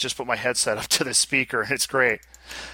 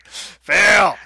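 loud, raised, teasing call-out; higher, louder, playful heckle; mock verdict; 4 semitones higher, 7 dB louder than usual. Real, not acted. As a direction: call it out loudly like a jeer. Teasing co-host's bad pronunciation.

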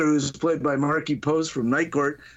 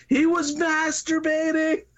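high, drawn-out mock holler; calling out; 12 semitones higher, slower than usual. Mary's brother tells the gathered neighborhood, high drawn-out call. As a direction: announce it to the whole street, stretched out.

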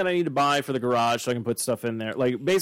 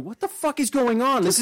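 much higher, bewildered outburst; baffled burglar; 9 semitones higher than usual. Pretend. Burglar confused by the English bobbies, in high bewildered disbelief. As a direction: sound completely lost and confused.

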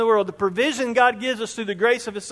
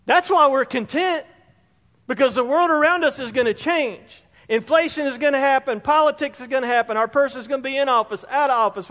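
fervent preaching; 4 semitones higher than usual. Real, not acted. Preaching at full force.

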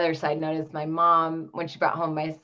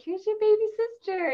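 put-on much higher sing-song voice for a little boy; bright high parent voice presenting the baby; talking to a child. Parents presenting the new baby to her young son, much higher sing-song.

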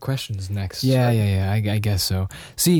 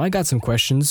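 usual speaking voice

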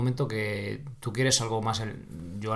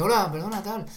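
higher, unsure voice; hesitant stranger; 6 semitones higher than usual. Retold or imitated. Quoting the hesitant man at the pool, higher. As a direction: play a doubtful stranger. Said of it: "un tío así como dudoso".

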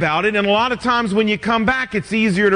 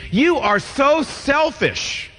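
slow, higher, accusing spouse; 4 semitones higher, slower than usual. Retold or imitated. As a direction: pile on the accusations slowly. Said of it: "skip from that to saying".

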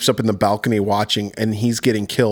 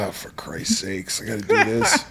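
grumbling dad actor; 5 semitones lower, 7 dB quieter than usual. Retold or imitated.

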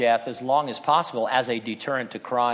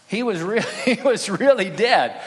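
laughing through "he was really dead", higher and louder; 6 semitones higher, 4 dB louder than usual. Real, not acted. Preacher to congregation after mocking the swoon theory, laughing. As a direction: chuckle out the punchline at your own joke.

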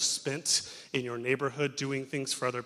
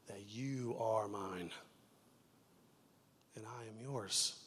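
tender; 3 semitones lower, 9 dB quieter, slower than usual. Real, not acted. Tender climax of sermon illustration.

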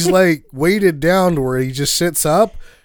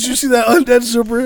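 mock amazed; 11 semitones higher, 6 dB louder, faster than usual. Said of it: "I was like".

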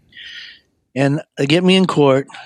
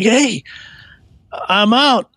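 excited greeting; 9 semitones higher, much slower than usual. Retold or imitated. Greeting brother at door.

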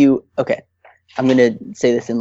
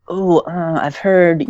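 higher, hesitant quoted voice; hesitant naysayer; 5 semitones higher, slower than usual. Retold or imitated. Acquaintance reacting to plans to see a psychiatrist, hesitant. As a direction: imitate someone doubtfully passing on hearsay. Voicing someone discouraging therapy.

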